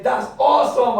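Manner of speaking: high, loud fake enthusiasm; mock cheerful customer voice; forced cheer. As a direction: put on a bright smile for the crowd. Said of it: "I had to smile".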